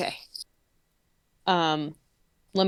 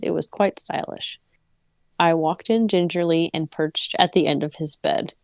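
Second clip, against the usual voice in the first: story narration; 3 semitones lower than usual. Acted. Reads the story aloud.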